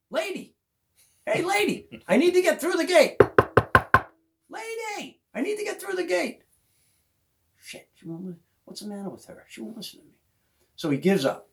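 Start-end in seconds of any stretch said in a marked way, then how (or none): 0.1-6.4 high, raised mock yell to someone inside; calling out. Kenny calling to woman at window. 7.6-10.1 soft, high muttered complaint; grumbling. Retold or imitated. Kenny grumbling after being ignored.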